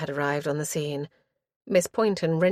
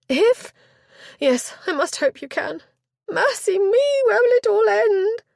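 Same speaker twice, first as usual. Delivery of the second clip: high, loud, fast frantic lady; a flustered chaperone insisting she be heard; high loud and hurried, close to panic; agitated duenna; 6 dB louder than usual. Acted. Shaking duenna laments.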